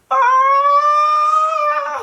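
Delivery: very high, loud labour scream; a retold scream at full pitch; imitated scream. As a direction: let out a piercing yell as if pushing. Retelling Nana screaming again.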